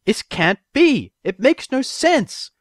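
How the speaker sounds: slow, high, loud yell; frustrated yelling. A narrator's reading. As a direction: pound out each word in disbelief. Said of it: "in frustration".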